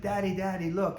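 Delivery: put-on high, eager child calling her dad; excited little girl. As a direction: play the daughter thrilled by the view, pulling at Daddy. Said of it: "freaking out".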